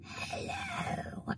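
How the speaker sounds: barely-there voice, almost no sound; voiceless croak. Retold or imitated. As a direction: imitate waking up with your voice gone. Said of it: "I couldn't even talk".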